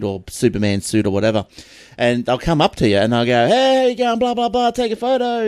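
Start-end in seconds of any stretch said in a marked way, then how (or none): 3.5-5.5 high, drawn-level hustler's patter; street hustler pitch. Retold or imitated. Costumed hustler coaxing tourists into a photo, high-pitched mock pitch. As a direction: imitate an over-eager street tout.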